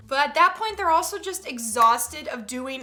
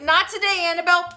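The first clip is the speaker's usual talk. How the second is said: firm raised warning; stern mom voice; 5 semitones higher, 7 dB louder, faster than usual. Acted. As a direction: snap it like a fed-up mom. Parent scolding kid, acted.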